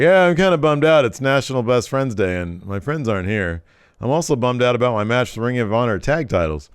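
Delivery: hammed-up sulky wrestler whining about his friends; imitating bummed Trent. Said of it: "typical Trent fashion. It's so, like, underwhelmed".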